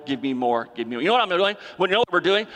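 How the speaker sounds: normal talking voice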